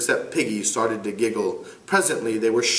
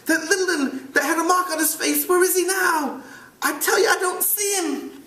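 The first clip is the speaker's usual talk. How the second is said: put-on high, panicky boy's voice; Piggy gasping. Piggy searching for the missing boy, rising in pitch. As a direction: push it out anxiously.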